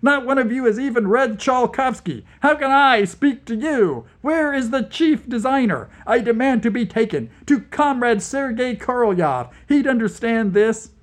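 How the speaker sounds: high, loud outraged tirade; furious shouting at the psychics, loud and high; raging shout. Acted. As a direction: keep screaming your demands. Vlad raging at interrogators.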